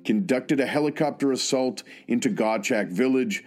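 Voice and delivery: formal, measured award citation read aloud. Real, not acted. Host sharing a fallen soldier's citation with everybody, formal reading. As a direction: read it out as an official military citation.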